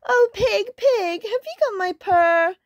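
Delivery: high, pleading kitten voice; squeaky storybook kitten; little kitten. A narrator's reading. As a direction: ask it like a tiny anxious kitten. Kitten asks pig in story.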